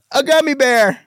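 very high, squeaky comic voice; exaggerated punchline. Shown as a demonstration. Comic working on his delivery, squeaky punchline. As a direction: sing out the answer in a high squeak. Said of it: "on the delivery".

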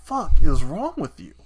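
exasperated disbelief. Retold or imitated. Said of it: "I'm just like".

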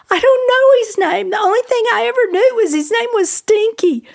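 high, wailing retold confession; imitated tearful distress. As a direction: act out an upset woman pleading to be understood. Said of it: "And she just sobbed".

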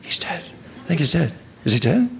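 hushed, very soft rumour; crowd murmur. Acted. People in the crowd about the boy, murmuring. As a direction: murmur it low, passing it along. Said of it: "The murmur".

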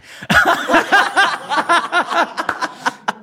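high, hearty burst of laughter; big high laugh. Real, not acted. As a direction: laugh it out, carried away by the memory. Laughing recalling train crash.

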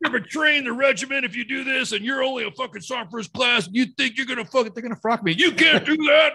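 hammed-up outrage at a traitor; scolding sergeant major. Bragg sergeant major chewing him out, mock outraged. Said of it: "I call back to Bragg".

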